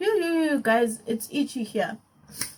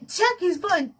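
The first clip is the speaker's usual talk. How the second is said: ordinary talk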